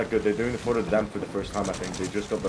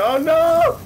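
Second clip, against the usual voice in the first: sudden high yelp of panic; loud, high cry of despair; dismayed yell; 9 dB louder than usual. Real, not acted. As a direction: yell it as the ship goes down. Enemy doing it, boat sinking.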